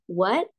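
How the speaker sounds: WHAT rising like a question; second tone. Shown as a demonstration. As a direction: let what glide upward. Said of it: "kalau nada dua". (what dengan nada dua yang naik)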